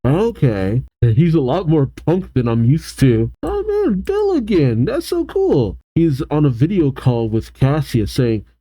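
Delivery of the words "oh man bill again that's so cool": very high, gushing delight; real excitement, pitched way up. Real, not acted. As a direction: squeal a little at a favourite's return. Delighted at returning character.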